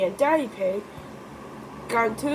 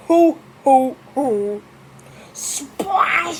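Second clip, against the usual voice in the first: loud playful hoo and splash, higher; loud splash cry; 4 semitones higher, 7 dB louder, much slower than usual. Pretend. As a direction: shout the big mud splash. Daddy Pig's big splash.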